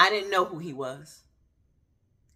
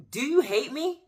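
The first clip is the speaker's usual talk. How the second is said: higher, louder, anguished question as in tears; anguished plea; 10 semitones higher, 4 dB louder than usual. Retold or imitated. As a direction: cry it out like a child's tantrum. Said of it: "I was not sharing that question, um, that calm".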